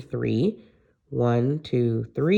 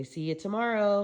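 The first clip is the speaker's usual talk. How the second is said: put-on prompting a child; 7 semitones higher, 6 dB quieter than usual. Shown as a demonstration.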